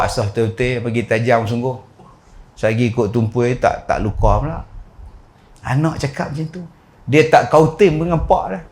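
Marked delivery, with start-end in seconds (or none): none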